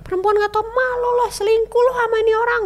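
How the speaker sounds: high, loud, contemptuous accusation; furious ex-husband shaming his wife; husband's scornful yell. Retold or imitated. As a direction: hurl the accusation at her. Husband insulting her, quoted.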